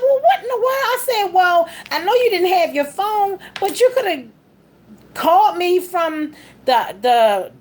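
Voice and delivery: high-pitched, incredulous outburst, retold; exasperated scolding. Wife asking husband why he didn't call, exasperated. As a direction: start very high in disbelief, then ease down.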